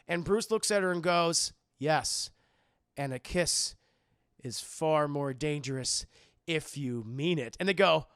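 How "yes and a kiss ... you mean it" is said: low, slow, weighted delivery; hammed-up brooding seduction; mock-romantic intensity. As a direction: draw it out low with pauses between phrases. Bruce voiced, romantic movie line.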